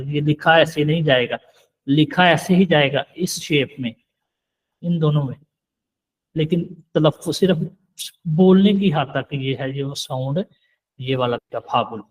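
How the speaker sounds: usual speaking voice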